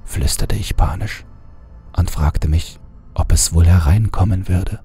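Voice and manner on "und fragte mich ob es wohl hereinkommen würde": ordinary delivery, nothing marked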